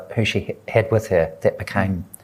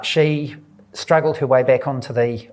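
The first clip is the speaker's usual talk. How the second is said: normal talking voice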